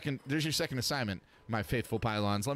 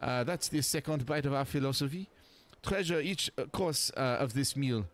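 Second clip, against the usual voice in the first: put-on French accent; 5 semitones higher than usual. Sophie voice continues.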